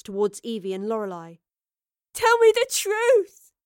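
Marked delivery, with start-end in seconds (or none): 2.1-3.5 loud, high, anguished plea; sobbed. A narrator's reading. Betrayed Astrid demanding answers, sobbing loudly. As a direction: plead slowly at the edge of sobbing. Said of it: "she sobbed".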